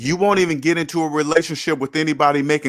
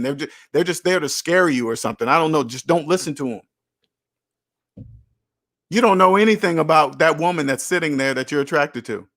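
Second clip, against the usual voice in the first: usual speaking voice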